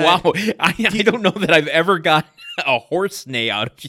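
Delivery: laughing. Real, not acted. Laughing at partner's loud laugh.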